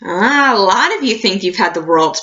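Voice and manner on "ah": ordinary talk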